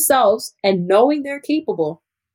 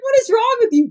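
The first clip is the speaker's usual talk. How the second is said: mock exasperation. Teacher retells telling off her students, high mock exasperation. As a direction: playfully scold them in a high pitch.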